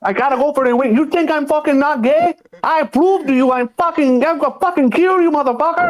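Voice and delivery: high falsetto rage, fast at the end; mock furious woman. Imitating Amanda threatening to kill him, high falsetto. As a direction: squeal out a hammed-up death threat.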